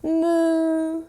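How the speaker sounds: a long playful moo; cow moo. Shown as a demonstration. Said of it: "we're gonna moo".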